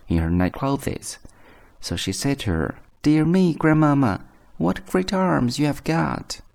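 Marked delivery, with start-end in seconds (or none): 3.0-6.4 put-on little girl's much higher voice. A narrator's reading. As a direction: exclaim high like an astonished child. Little girl addresses disguised wolf.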